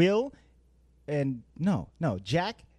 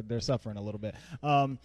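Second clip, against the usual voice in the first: usual speaking voice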